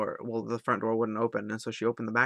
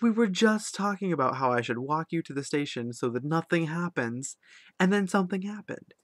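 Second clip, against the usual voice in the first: higher, rising alarmed rant; agitated disbelief; 6 semitones higher, 4 dB louder than usual. Retold or imitated. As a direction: scold her in worried disbelief. Reproaching friend after mugging.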